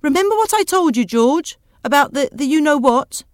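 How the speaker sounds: high, loud reminder called after him; calling a warning. Acted. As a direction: warn him pointedly from the doorway. Wife calls warning after departing husband.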